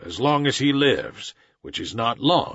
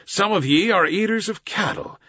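ordinary delivery, nothing marked

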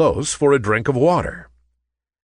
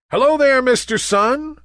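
higher, louder cheerful greeting; chorus greeting; 14 semitones higher, 3 dB louder than usual. A narrator's reading. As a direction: greet the sun all together. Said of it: "said the clouds in chorus".

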